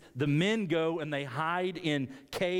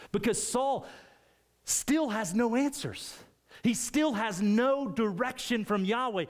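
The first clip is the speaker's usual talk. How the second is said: urgent emphasis; 8 semitones higher than usual. Real, not acted. Preacher to the congregation on Saul's plight, higher and insistent. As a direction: press the tragedy home.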